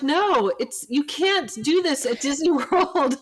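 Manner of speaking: retold busybody mom, half laughing; mock indignant stranger. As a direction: ham up the busybody mom's protest, cracking up. Stranger insists, teller laughing.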